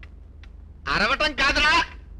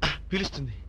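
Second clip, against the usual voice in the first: much lower and softer, almost under the breath; hushed aside; 10 semitones lower, 11 dB quieter than usual. Acted. Hiding man mutters to himself, hushed. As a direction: keep it down so nobody hears. Hiding, afraid of being caught.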